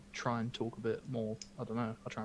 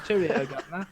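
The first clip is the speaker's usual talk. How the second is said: louder, higher hammed-up cockney toff; mock British accent; 4 semitones higher, 7 dB louder than usual. Teasing the American: hammed-up British accent. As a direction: bellow it like a music-hall Englishman. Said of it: "Pip, Pip".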